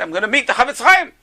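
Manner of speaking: high-pitched, eager storytelling quote; excited. Retold or imitated. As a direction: imitate a jumpy, thrilled traveler. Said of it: "Very excited".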